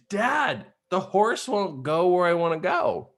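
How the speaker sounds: high-pitched mock holler for dad; exasperated, hammed-up call for help; imitated yell. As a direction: act out a kid calling through the trees. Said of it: "I was yelling to the trees".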